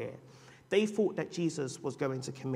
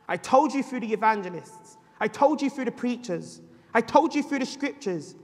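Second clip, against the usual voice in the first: high, loud rising litany on told; building preaching; 6 semitones higher, 8 dB louder than usual. Acted. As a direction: hammer each line home. Preaching at full force.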